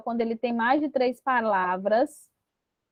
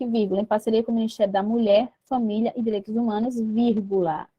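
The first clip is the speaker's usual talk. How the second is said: fast rereading; much faster than usual. Shown as a demonstration. Teacher quickly rereading the corrected essay aloud. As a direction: rattle through the corrected text quickly.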